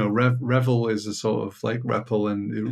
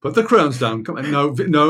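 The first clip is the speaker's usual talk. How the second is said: put-on scolding a toddler; 4 semitones higher, 4 dB louder than usual.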